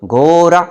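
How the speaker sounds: loud, high घोड़ा with R for the hard ḍ; teacher's mispronunciation. Acted. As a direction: call out घोड़ा to the class as "gora". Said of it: "डे को रे बोलते थे". (ड़ को र बोलते मास्टर, ज़ोर से घोड़ा)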